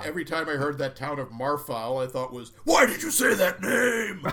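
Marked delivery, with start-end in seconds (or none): 2.6-4.3 shouting in mock rage; angry outburst. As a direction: yell it like a furious accusation. Angry outburst at hearing Marfa.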